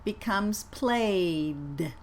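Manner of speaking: "played" drawn out very slowly with a voiced D; teacher models "played" very slowly. Shown as a demonstration. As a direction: let the D in "played" buzz. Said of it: "let's practice these words".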